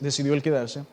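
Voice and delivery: ordinary talk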